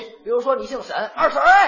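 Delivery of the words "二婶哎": loud high call down the street. Acted. Calling after his friend from behind, loud and high. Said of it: "你喊我一回".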